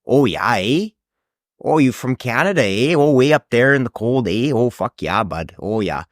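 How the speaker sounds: hammed-up Canadian accent, lilting up and down. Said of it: "when people do the Canadian accent".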